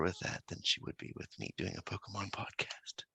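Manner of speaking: hushed unvoiced whisper; whispering on the phone. Real, not acted. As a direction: whisper so nobody wakes. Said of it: "I have to whisper".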